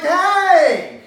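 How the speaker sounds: very loud, high drawn-out shout; escalated, hammed-up cry of objection; outraged yell. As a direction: wail your protest at full voice. Loudest protest at seeing tongue.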